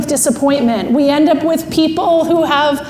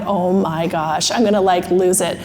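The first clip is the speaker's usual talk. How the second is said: retold impatience; 7 semitones lower, 4 dB quieter than usual. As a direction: mutter it to yourself, holding it in. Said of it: "I told you my land is speed".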